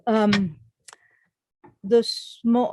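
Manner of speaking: ordinary delivery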